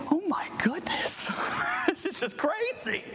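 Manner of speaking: high, breathless disbelief; amazed. Retold or imitated. Watching the car giveaway, high amazed exclaim. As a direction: gasp it like you can't believe your eyes. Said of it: "And I think".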